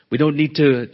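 ordinary delivery

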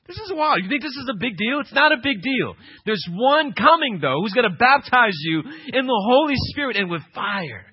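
ordinary delivery

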